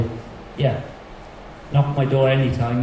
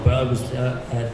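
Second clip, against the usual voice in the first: normal talking voice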